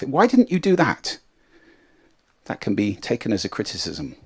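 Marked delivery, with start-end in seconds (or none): 0.0-1.2 higher-pitched challenging why-question; tough questioning. Acted. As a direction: ask it as a mild reproach. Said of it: "It can be taken quite tough".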